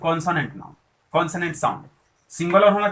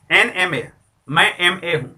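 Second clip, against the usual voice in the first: ordinary delivery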